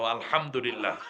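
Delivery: loud chanted alhamdulillah; group shout of praise. Real, not acted. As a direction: call it out together. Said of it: "তোমরা একটা আওয়াজ দিয়ে". (জোরে আলহামদুলিল্লাহ)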